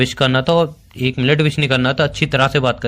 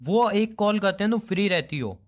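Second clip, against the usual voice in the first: higher-pitched indignant reproach; aggrieved accusation; 8 semitones higher than usual. Acted. Boyfriend accusing her of being free for friends, aggrieved. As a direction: raise the pitch in hurt complaint.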